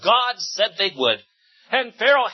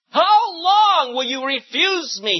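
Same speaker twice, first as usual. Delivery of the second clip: slow, higher pleading voice; 8 semitones higher, 4 dB louder, slower than usual. A narrator's reading. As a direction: plead it slowly and earnestly. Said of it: "he pleaded".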